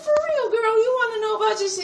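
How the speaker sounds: can hardly believe her luck; thrilled disbelief. Acted. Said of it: "And I said".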